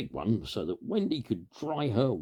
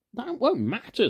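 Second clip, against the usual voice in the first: put-on high, loud boyish insistence; eager Peter; 7 dB louder than usual. A narrator's reading. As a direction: brush the worry aside like an excited boy. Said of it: "said Peter".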